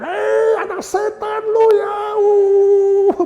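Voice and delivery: very high, loud screeching parent impression; mock screaming. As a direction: shriek it like a raging parent, hammed up. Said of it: "Bukan teriak-teriak".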